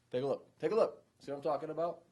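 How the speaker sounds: high and loud, pushing the boss to look; eager trooper showing off proof. Retold or imitated. Trooper urging his supervisor to look at the tape, excited. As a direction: sell it like vindicated proof to the supervisor.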